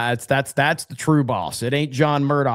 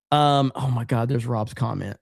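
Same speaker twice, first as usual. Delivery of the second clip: normal talking voice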